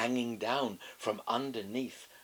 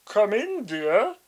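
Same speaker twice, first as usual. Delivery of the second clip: wolf faking a high, loud granny voice, hesitant; disguised voice; 10 semitones higher, 11 dB louder, slower than usual. A narrator's reading. Wolf pretending to be grandma, clumsy disguised voice. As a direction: clear throat and fake an old lady badly. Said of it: "trying to disguise his voice".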